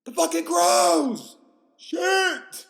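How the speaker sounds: high, full-volume shout; hammed-up panicked hollering; mock battle cry. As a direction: yell as if under attack from birds. Acting out a crow attack.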